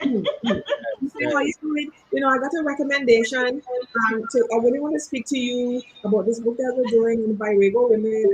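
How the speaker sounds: a lightly voiced Trinidad caller; [name]'s Caribbean accent. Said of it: "I have to do the accent".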